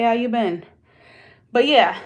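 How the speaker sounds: usual speaking voice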